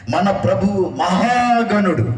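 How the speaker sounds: high, drawn-out scripture call; proclaimed verse. Real, not acted. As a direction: stretch it out high for all to repeat. Leads congregation in verse reading.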